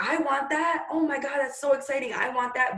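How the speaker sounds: high, eager, breathless wanting; a mock giddy I-want-that; envious squeal. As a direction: gush like a jealous teammate. Team members envious of a diamond.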